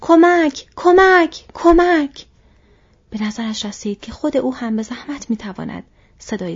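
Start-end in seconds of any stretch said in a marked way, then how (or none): 0.0-2.2 high, very loud repeated shouts; cry for help. A narrator's reading. Frodo running down the road, yelling for help. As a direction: yell desperately for rescue. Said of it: "در طول جاده دوید و فریاد زد".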